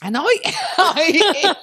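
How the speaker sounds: high, loud laughing stammer; cracking up mid-sentence. Real, not acted. Cracking up while telling the story to her friend.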